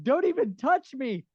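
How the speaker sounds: high-pitched yelp, then slow protest; hammed-up horrified squeal; mock panicked recoil. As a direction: imitate shrinking back from someone's touch. Acted quote recoiling from bloody man.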